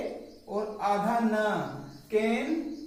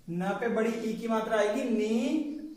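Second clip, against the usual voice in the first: ordinary delivery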